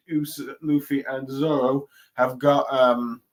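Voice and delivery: usual speaking voice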